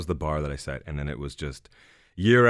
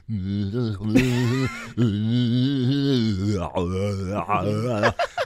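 long vocal warm-up hum opening into la-la; humming. Shown as a demonstration. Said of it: "I'll be humming like".

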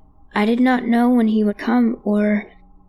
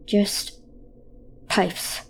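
quiet dismissive dad; 6 dB quieter than usual. Retold or imitated. Dad's voice repeated quietly.

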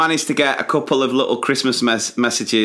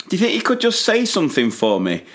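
higher, pleading little ask; coaxing plea; 8 semitones higher than usual. Retold or imitated. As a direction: gently beg the kid to say it.

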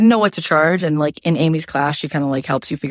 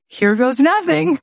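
nervous leap; 9 semitones higher than usual. Retold or imitated. Nervous start of webinar.

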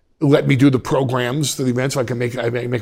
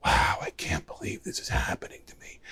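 hushed, wide-eyed mock wonder; awed whisper; 5 semitones lower, 12 dB quieter than usual. Kid looking round at his environment, awed whisper. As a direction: whisper it as a private marvel. Imagined awed thought, never had.

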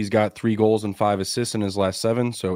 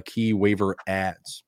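ordinary delivery

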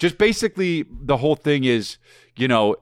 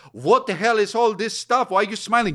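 suspicious Russian; 9 semitones higher than usual. Retold or imitated. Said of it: "and the Russians like".

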